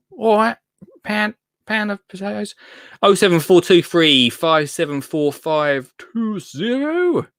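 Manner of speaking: put-on posh English accent, slow and playful; hammed-up posh accent. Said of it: "I'll either go really posh".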